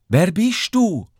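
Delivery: high, drawn-out call from the hut; princess calling out. A narrator's reading. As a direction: call the question slowly in a high voice. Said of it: "ruft die Prinzessin aus der Hütte". (hoch und gedehnt gerufen)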